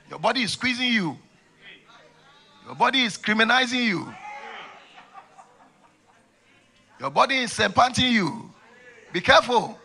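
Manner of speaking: high, loud fiery warning; preaching at full force. Acted. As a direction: preach it with urgency.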